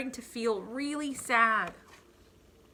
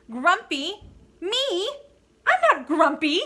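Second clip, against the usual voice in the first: higher, louder, offended protest; indignant denial; 8 semitones higher, 8 dB louder than usual. Acted. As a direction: scoff at the very idea, loud and high. Monkey denies being grumpy.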